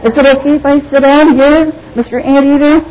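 high, small, timid voice asking permission; a lion squeaking politely like a scared kitten; timid meek lion. Pretend. Preacher mocking a timid lion, high and meek. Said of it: "You don't have a lion going".

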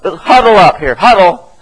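loud acted coach's call; coach calling huddle. Coach gathers a fragmented team, calling out loudly. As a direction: call the scattered team in, raising your voice. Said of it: "like a coach bringing a team".

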